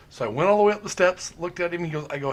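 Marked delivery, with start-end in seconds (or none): none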